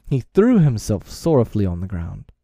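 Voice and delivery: usual speaking voice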